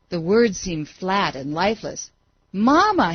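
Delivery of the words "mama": higher, louder desperate call; scream. A narrator's reading. Falling boy calling his absent mother, screamed. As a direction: cry for mother into darkness. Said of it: "he screamed".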